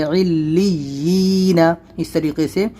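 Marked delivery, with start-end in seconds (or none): 0.0-1.6 علیین stretched slowly, lam doubled, ya sound kept clear; slow recitation. Shown as a demonstration. Teacher showing the ya sound, reciting slowly. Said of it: "یعنی گزارنا ہے".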